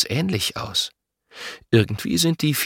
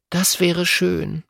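put-on a girl's higher voice, dreamily wishing; wistful girl; 11 semitones higher than usual. A narrator's reading. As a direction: sigh the wish like a dreaming child. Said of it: "Lotta seufzt". (träumerisch seufzendes Mädchen)

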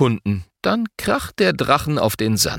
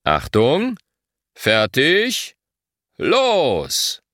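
"Achtung" rising, "fertig" rising, "los" released higher and falling; race-start count with pauses before "fertig" and "los"; start signal; 4 semitones higher, much slower than usual. A narrator's reading. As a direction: hold suspense on "Achtung" and "fertig", then send them off with "los". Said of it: "Papa gibt das Signal".

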